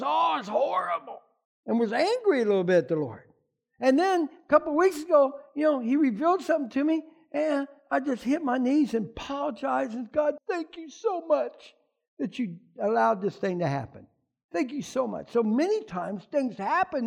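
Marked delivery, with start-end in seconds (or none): 0.0-1.2 higher, shouted complaint; a shout of anger at the Lord; angry outburst. Retold or imitated. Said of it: "at first I thought it was". 10.1-15.3 thanking God on his knees, softly; grateful prayer. Retold or imitated. Prayer of thanks after kneeling.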